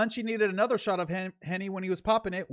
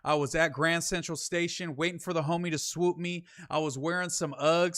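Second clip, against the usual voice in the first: ordinary talk, nothing marked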